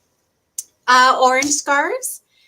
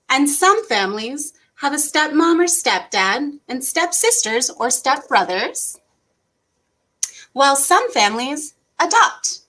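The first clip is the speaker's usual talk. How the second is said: put-on gentle, even read-aloud voice for kids; reading to children. Teacher reading a family book to kids, gentle storytime voice. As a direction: read the picture book to little ones.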